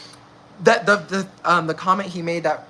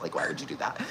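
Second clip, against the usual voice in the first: softer, whispered disbelief; whispered scolding; 14 semitones lower, 11 dB quieter, faster than usual. Retold or imitated. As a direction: hiss the question under the breath. Scolding friend, drops to whisper.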